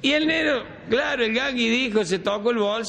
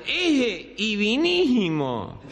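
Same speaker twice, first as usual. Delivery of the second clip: slow nasal garble with lost consonants; gangoso; much slower than usual. Acted. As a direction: honk "Ese y vinísimo", drawn out through the nose. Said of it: "medio gangoso era". ("Ese y vinísimo" gangoso y arrastrado)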